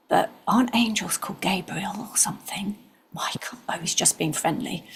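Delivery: hushed inner thinking, breathy and soft; whispered thought. Retold or imitated. Wondering to herself about the angel, whispered. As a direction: whisper it as if to yourself.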